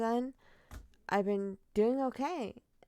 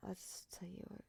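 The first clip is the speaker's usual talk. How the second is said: hushed whisper; 16 dB quieter than usual. Real, not acted. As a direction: drop to a whisper. Whispered aside.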